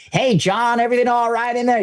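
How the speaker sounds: high-pitched imitation of a cop calling over; American policeman. Cop checks on John over the wall, high imitated hail. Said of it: "He's like".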